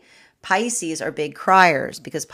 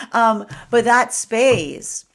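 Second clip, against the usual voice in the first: ordinary talk, nothing marked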